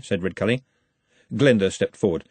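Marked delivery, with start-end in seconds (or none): none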